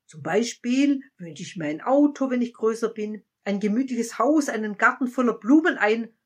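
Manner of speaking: put-on a child dreaming aloud of everything; eager wishes. A narrator's reading. Child eagerly tells the wise man its wishes.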